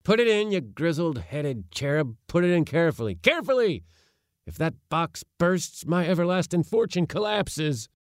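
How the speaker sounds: high, urgent scolding orders; agitated command. A narrator's reading. Uncle fearing for his box as it is loaded, agitated. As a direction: fuss loudly over precious cargo.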